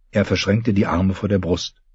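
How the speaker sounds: ordinary delivery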